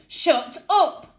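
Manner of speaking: high angry yell with a rise; screamed. Acted. Boy screams at the taunting girl, high angry yell. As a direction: scream it at her. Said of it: "screamed Jeff".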